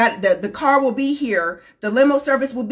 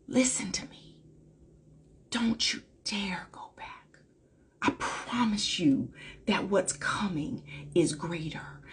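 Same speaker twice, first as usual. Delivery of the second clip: whispered, pressing close to the listener; urgent whisper; 5 semitones lower, 12 dB quieter than usual. Acted. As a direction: lean in and whisper the promise. Intimate urging of listener.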